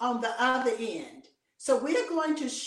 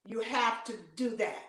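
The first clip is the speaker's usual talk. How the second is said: normal talking voice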